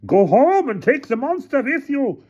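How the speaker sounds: very high heckling shout. A narrator's reading. Another villager heckles Hannah, high shout. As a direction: yell it high from the mob. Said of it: "Another shouted".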